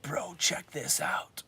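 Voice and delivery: hushed conspiratorial whisper; mock whisper. Buddy whispering a secret find to his friend.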